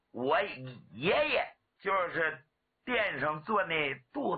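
halting stutterer's boast; stammer. Acted. Stuttering warrior introduces himself to the crowd, stammering. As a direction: stick on syllables, then push the phrase out. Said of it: "人送外号小磕".

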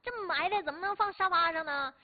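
high, loud scolding mother; angry mom. Retold or imitated. Mother scolding her child about the ball, loud. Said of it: "妈妈这生气说".

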